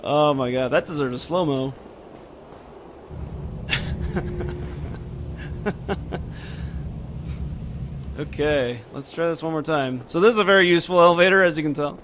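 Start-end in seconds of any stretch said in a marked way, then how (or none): 3.1-8.1 breathless, voiceless laughing fit; silent wheezing laugh. Real, not acted. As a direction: laugh with no voice left. Keeps laughing after the kick.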